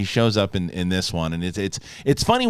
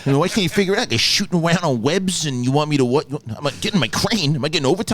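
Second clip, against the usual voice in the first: mock New York worker; 3 semitones higher than usual. Voices lazy New York worker.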